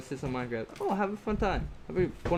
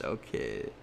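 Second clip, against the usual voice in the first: ordinary talk, nothing marked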